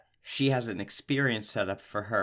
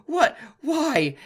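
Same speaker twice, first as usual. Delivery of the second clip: high, loud mock shriek; shocked grandma; 6 dB louder than usual. Grandma catches granddaughter with beer, loud high mock shock. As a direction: squeal like a startled grandma. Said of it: "she's like".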